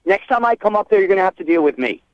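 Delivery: ordinary delivery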